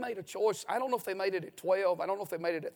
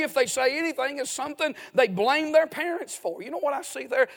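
higher and louder, building; rising indignation; 6 semitones higher, 6 dB louder than usual. Real, not acted. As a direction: build the outrage step by step.

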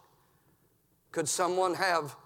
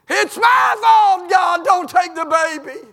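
loud, high, slow pleading wail; preacher voicing David's desperate prayer; begging David; 10 semitones higher, 10 dB louder, slower than usual. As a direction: beg God to spare the child. David begging God, voiced.

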